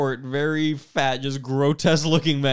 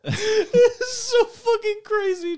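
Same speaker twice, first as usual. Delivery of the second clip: laughing; much slower than usual. Real, not acted. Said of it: "Oh my gosh".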